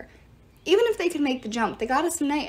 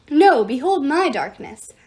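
loud boasting; 3 semitones higher, 8 dB louder than usual. Acted. Demons boasting, competing.

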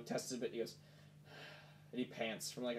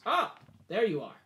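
guard's greeting; 8 semitones higher, 7 dB louder than usual. Acted. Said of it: "and goes".

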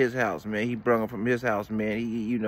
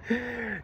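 short high laugh; a real laugh; burst of laughter; 12 semitones higher, 5 dB quieter than usual. Real, not acted. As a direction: crack up at his own skit. Laughing after the skit.

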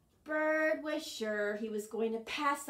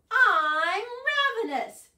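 loud, raised hungry wail; desperately hungry; 11 semitones higher, 13 dB louder than usual. Acted. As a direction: complain loudly of hunger. Starving Bird complains.

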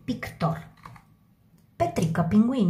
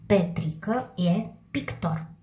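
slow dictation; slower than usual. Shown as a demonstration. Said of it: "teacher dictates sentence".

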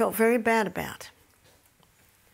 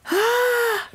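mock frustrated cry; 6 dB louder than usual. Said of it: "you just feel like screaming".

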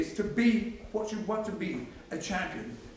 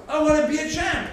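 raised voice slogan; 7 semitones higher, 8 dB louder than usual. Shown as a demonstration. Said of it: "Just say what you like".

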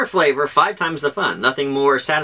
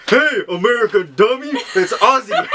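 high-pitched, laughing mock correction; mock scolding commenters; 8 semitones higher than usual. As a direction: imitate a crowd of indignant commenters. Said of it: "everybody was like".

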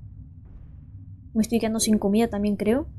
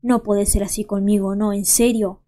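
ordinary talk

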